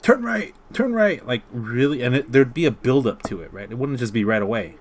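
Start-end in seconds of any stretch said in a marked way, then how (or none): 0.0-1.2 shrill, loud anime navigator yelling; overacted anime dub. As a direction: scream the direction like an anime character. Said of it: "it'll be like".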